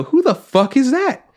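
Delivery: high, drawn-out incredulous outburst; retold shock, high and slow. Retold reaction to unrecognized actress.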